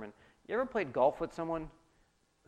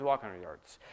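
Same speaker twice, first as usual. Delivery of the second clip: walk dropped much lower; walking pace; 8 semitones lower than usual. Shown as a demonstration. Pastor repeating the walk line, dropped low. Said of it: "sprint hundred yards".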